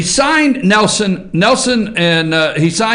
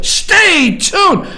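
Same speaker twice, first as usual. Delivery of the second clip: mock announcer shout; 10 semitones higher than usual. Shown as a demonstration. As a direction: bellow "Stay tuned" like a hyped news teaser. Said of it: "That's what the media wants you to do".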